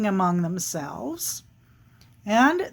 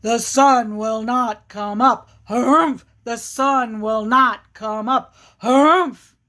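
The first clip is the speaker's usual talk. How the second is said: emphatic bear chant with punched humphs; grumpy, insistent storybook bear; stubborn bear; 3 dB louder, slower than usual. Acted. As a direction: declare it firmly, ending in a loud huff. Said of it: "with the bear saying".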